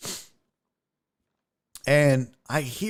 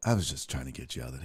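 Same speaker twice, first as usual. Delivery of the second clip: soft, fast low murmur; quiet aside; 11 dB quieter, much faster than usual. Retold or imitated. Comic whispering to the kid on the plane, quiet fast aside. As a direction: say it under your breath. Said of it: "I was like".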